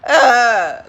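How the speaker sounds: loud mock moan of embarrassment; embarrassed moan. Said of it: "moan in uncomfortability and embarrassment".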